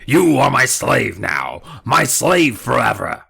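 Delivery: loud, slow, dragged-out dummy voice; creepy dummy threat. Acted. The dummy tells Amy she's his slave forever, slow and loud. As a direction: savour the threat slowly.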